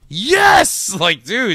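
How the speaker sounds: very high, loud victory cry; hammed-up hero's shout of triumph; mock triumphant shout. Acts out Flash's triumphant victory cry.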